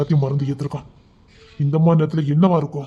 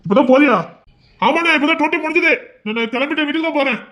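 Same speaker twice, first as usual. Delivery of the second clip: voiced dialogue; 10 semitones higher, 4 dB louder than usual. High put-on dialogue voice.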